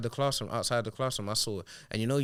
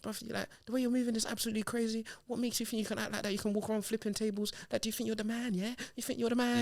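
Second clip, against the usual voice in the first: much higher, indignant imitation; retold telling-off; 12 semitones higher than usual. School staff confronting the boy over flipped tables, high-pitched mock scolding. Acts out others telling him off.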